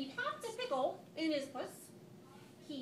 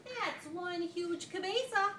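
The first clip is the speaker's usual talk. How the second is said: amazed; 6 semitones higher, 5 dB louder, slower than usual. A narrator's reading. Cat boy amazed at mirror.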